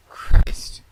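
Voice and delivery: breathless whispered oath; whispered Christ; whispered curse. Acted. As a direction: hiss it under your breath. Muttered oath alone in hall.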